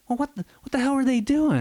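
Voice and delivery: loud, high put-on installer griping; hammed-up outraged worker; mock exasperated installer. Installer cursing, voiced loud.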